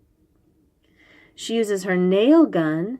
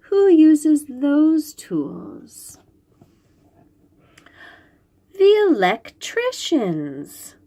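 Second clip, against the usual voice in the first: put-on reading to children; 5 semitones higher, slower than usual. Reading picture book to children.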